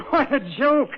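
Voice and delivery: high, laughing near breakdown; hysterical laugh. Acted. Hysterical laughter, Julie remarks.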